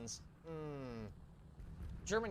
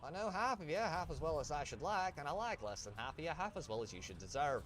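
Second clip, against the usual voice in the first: lilting Irish accent, higher and louder; 6 semitones higher, 4 dB louder than usual. Real, not acted. Gamer quoting at the players he faces, in an Irish accent. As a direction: slip into a lively Irish accent. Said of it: "Why did I slip into an Irish accent there?".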